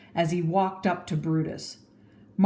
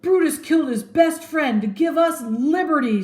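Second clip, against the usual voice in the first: high, loud defiant call; crowd shout; 9 semitones higher, 6 dB louder than usual. Acted. Someone else in the crowd shouting, loud.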